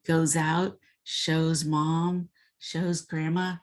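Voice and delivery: ordinary delivery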